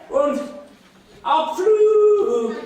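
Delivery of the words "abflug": higher, louder launch shout; takeoff cry. Acted. The witch shouts her takeoff as she flies away. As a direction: blast off into the air.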